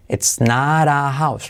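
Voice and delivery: "not" with its T said as a soft tapped R, flowing into "a"; slow model sentence with "not" tapped like "nar". Shown as a demonstration. Teacher models "not" for students, tapped soft R. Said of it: "la T de not se convierte en R suave".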